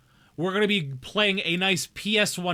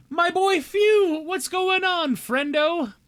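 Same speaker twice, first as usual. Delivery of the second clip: excited greeting; 14 semitones higher, 6 dB louder, much slower than usual. Real, not acted. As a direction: gasp then much higher, louder, slow delighted hello.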